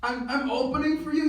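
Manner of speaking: loud, high-pitched astonishment; shocked disbelief. Retold or imitated. Young comic to his idol on getting the opening slot, shocked disbelief.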